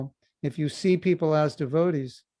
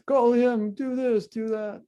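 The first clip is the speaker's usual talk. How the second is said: high, louder barked orders, mock-bossy; imitating demands; 9 semitones higher, 4 dB louder, slower than usual. Mimicking the morning calls before japa, high mock-bossy orders. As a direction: rattle off the orders like a pushy caller.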